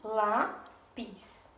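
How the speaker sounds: lápis split as LÁ, pause, PIS; syllable by syllable. Shown as a demonstration. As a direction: count out lápis in two separate syllables. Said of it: "quantas sílabas ela tem". (lápis separado em sílabas: lá, pis)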